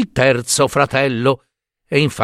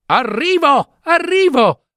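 high ringing call of arrival; shouting; 14 semitones higher than usual. Acted. Giambabbeo calling to his brothers as he gallops, high-pitched shout. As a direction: sing out, much higher, from far behind.

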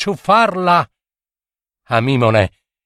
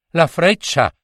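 usual speaking voice

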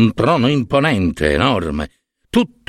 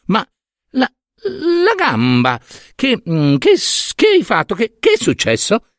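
much higher, put-on fussing mother voice; Hera, fake concern; 9 semitones higher than usual. Hera noticing her son's broken leg with much higher, falsely concerned voice. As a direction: coo over his leg with false worry.

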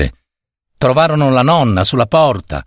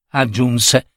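usual speaking voice

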